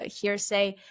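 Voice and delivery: ordinary talk, nothing marked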